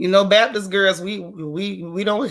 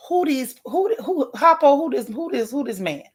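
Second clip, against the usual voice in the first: baffled who; 4 semitones higher, 3 dB quieter than usual. Real, not acted. Puzzled at unknown rapper names.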